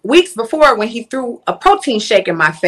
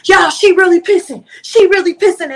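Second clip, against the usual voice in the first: mock-incredulous yell, much higher and louder; mocking boyfriend; 9 semitones higher, 6 dB louder than usual. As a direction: play the gloating ex calling it out. Boyfriend mocks her from doorway.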